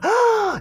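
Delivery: sharp high inward gasp. Shown as a demonstration. Teacher contrasting gasp with normal pitch, high gasp. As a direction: suck in a high, startled breath. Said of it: "instead of the".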